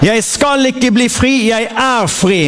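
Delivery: slow, proclaiming call for the congregation; preacher leading a spoken creed; leading a confession. Acted. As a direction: declare it for them to echo. Leads confession to repeat.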